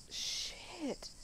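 breathy whispered shit; scared whisper; whispered curse. Real, not acted. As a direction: breathe it out, spooked. Scared in the dark.

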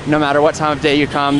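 normal talking voice

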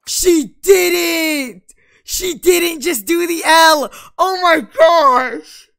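high, very loud, drawn-out shriek; shocked outburst. Real, not acted. Gamer seeing Lucy flash the L, shrieking in shock. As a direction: scream it in scandalized disbelief. Shocked reaction to game insult.

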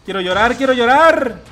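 loud, emotional cry of joy; overjoyed outburst. Real, not acted. Gamer overwhelmed by a win, loud joyful cry. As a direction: gush it out loud.

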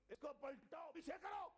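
rising into a high shouted order; commanding shout. Retold or imitated. Prophet commanding the angels, shouting. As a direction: order them to turn it back, full force. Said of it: "یہ لہجہ میں کس لیے بتا رہا ہوں".

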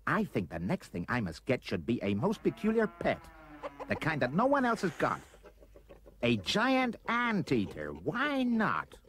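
put-on high, excited young boy's voice; eager boy. A narrator's reading. Roy gets an idea for a peculiar pet, excited. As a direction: voice rising high with delight at the idea.

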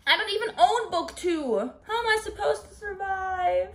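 high, whiny lament; despairing wail. Real, not acted. Reader without book two, despairing wail. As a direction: wail it as if all is lost.

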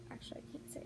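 whispered, catching herself; secretive whisper. Real, not acted. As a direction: whisper it like a secret you almost spilled. Stops herself revealing a secret.

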